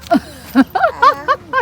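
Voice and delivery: high bursting laugh; laughter. Real, not acted. Mother laughing at her child's nose joke, bursting laugh. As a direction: let a helpless laugh out.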